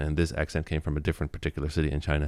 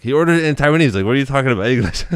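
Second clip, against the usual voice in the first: louder, higher imitation of a baffled clerk; incredulous correction; 8 semitones higher, 8 dB louder, faster than usual. Clerk to coworker about the coffee customer, incredulous. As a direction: retell it as a loud, puzzled objection.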